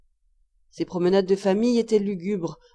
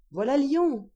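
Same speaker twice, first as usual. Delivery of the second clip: higher-pitched announcement of arrival; calling out; 5 semitones higher than usual. A narrator's reading. Passenger beside the narrator announces the city, calling out. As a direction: call it out on a raised pitch.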